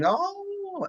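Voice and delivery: high, drawn-out delighted "oh"; excited child imitation.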